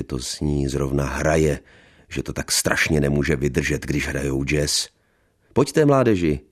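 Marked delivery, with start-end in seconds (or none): 5.5-6.4 higher, louder cheerful call. A narrator's reading. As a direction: call the young ones over. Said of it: "zvolala Alena".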